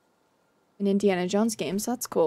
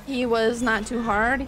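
ordinary talk, nothing marked

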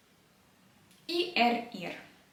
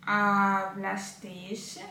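very slow, drawn-out syllables; slow model pronunciation; 2 semitones lower, much slower than usual. Shown as a demonstration. Teacher modelling a Spanish verb form, very slowly. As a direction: stretch the word out for learners to follow. Teacher repeats word for learners.

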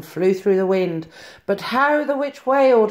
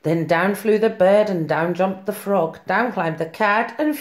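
ordinary delivery

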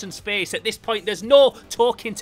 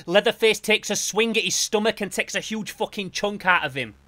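usual speaking voice

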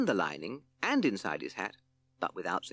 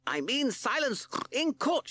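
high slow judge voice, barely louder; mock shout; 3 dB louder, slower than usual. Justice Pig orders the court slowly, high and cross. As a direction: drag out the order, much higher. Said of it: "shouted Justice Pig".

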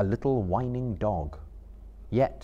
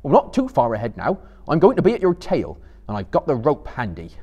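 louder, threatening Sam; wary Sam; 4 semitones higher, 8 dB louder, faster than usual. A narrator's reading. Sam warning Gollum about the rope, loud and wary. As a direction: warn him you'll be watching. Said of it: "cried Sam".

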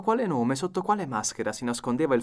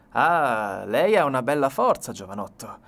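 louder, sardonic detective voice; mocking challenge; 6 dB louder than usual. A narrator's reading. Ganimard taunting the suspect, loud.

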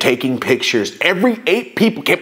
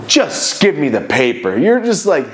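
ordinary talk

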